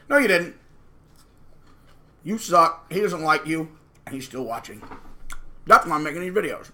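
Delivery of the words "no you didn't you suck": usual speaking voice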